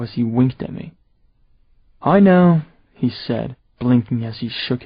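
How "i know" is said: high, loud smug admission; loud high smug boast. Acted. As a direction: own it proudly. Seth smug reply.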